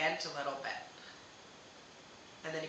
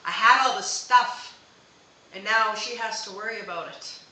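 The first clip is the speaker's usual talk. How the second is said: loud, higher, emphatic; voicing the late husband; 4 semitones higher, 12 dB louder than usual. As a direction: proclaim it loud for him. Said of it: "he's like".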